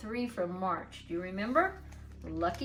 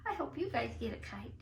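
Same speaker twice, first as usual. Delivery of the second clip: fast voiceless whisper; whispered aside; 6 dB quieter, faster than usual. Real, not acted. Teacher whispering an aside to children. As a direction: murmur it quickly aside.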